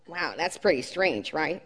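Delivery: low-key inner doubt; saying to oneself. Acted. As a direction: drop the voice, as if thinking aloud. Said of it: "you say to yourself".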